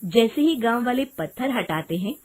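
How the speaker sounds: ordinary delivery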